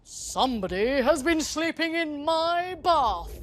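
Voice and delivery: indignant father; dad voice. A narrator's reading. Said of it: "said the daddy person".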